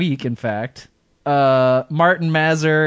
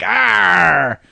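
pirate growl; 4 semitones higher, 4 dB louder than usual. Retold or imitated. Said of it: "she's like".

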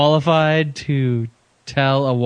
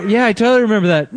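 eager fake agreement; 7 semitones higher, 4 dB louder, faster than usual. Imagined old friend bluffing memory.

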